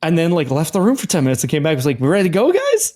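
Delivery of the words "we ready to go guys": bright high sing-song question; chirpy doctor. Retold or imitated. Doctor popping back in to the couple, cheery and high. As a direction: breeze back in all cheery.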